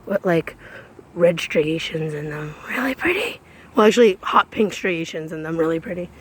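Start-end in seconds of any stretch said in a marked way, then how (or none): 2.7-3.4 soft unvoiced whisper. Real, not acted. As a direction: breathe it in a whisper. Whispered aside.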